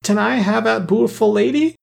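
put-on high, eager little-girl voice; enraptured child. A narrator's reading. Little Mary begs Claus for the dolly, enraptured. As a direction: babyish pleading, thrilled and high-pitched. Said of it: "clapping her hands together with rapture".

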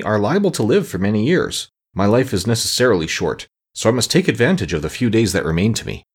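usual speaking voice

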